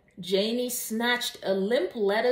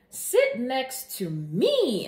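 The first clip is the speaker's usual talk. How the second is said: slow, higher, insistent demand; 5 semitones higher, 4 dB louder, much slower than usual. A narrator's reading. Janie pulls Mai to her seat, insisting. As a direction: press the words slowly like an order. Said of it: "insisted Janie".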